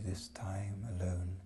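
lonely whispered line. Acted. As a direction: hush it like a confession. Poem drops to whisper.

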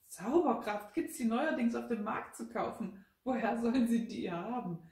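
loud, high-pitched scoffing; mocking. A narrator's reading. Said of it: "spottete Rosina".

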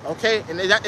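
normal talking voice

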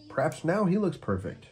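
usual speaking voice

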